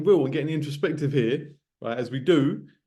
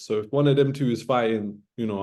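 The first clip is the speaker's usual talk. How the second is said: ordinary delivery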